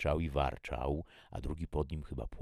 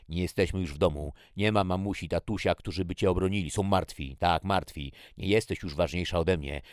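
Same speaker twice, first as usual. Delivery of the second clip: loud, raised, relentless tirade; angry snarl; 4 semitones higher, 8 dB louder than usual. A narrator's reading. Jackie says parents are dead, furious. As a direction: hammer each fact home angrily. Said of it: "warknęła".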